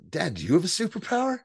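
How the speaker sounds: high, excited daughter voice; imitated excitement. Daughter asking her dad about powers, mock excited. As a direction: pitch it up like an excited kid. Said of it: "And she's excited, Lita is".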